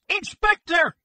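loud, very high frantic shriek; piercing cry of a distraught man. A narrator's reading. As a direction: shriek it out like a man bereft of his senses. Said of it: "he shrieked".

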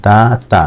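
দাতা slowly split as daa-taa; two syllables. Shown as a demonstration. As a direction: give দাতা two separate long beats. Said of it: "দুইবার". (দাতা ভেঙে "দা-তা" দুইবারে)

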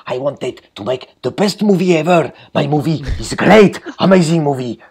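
hammed-up Spanish-accented director bragging. Mocking Jodorowsky's self-praise in a slow Spanish accent. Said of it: "avec son accent espagnol".

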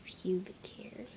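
hushed, shy whisper of a touchy word; embarrassed. Real, not acted. Shy girl whispering a taboo word to viewers. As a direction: whisper it like it's a secret.

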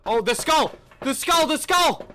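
excited shouting. Real, not acted. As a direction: shout out the find. Spotting the skull.

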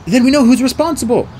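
high, loud, urgent; urgent accusation. A narrator's reading. Boy names who poisoned the tree, urgent. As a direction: burst out with the accusation.

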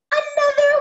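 high, loud announcement; excited reveal. Shown as a demonstration. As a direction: sing out the surprise, high and loud. Excitedly announcing the next letter.

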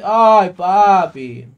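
loud, long drawn-out exclamation; big stretched cry of surprise; delighted outcry. Real, not acted. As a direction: wail it out in happy surprise. Reacts to stream donation.

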